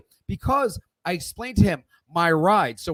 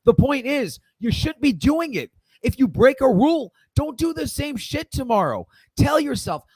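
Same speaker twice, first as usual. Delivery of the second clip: higher, louder push on the point; raised scolding rant; 6 semitones higher, 3 dB louder than usual. Real, not acted. Trader lecturing viewers who repeat mistakes, raised and scolding. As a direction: drive it home to careless traders.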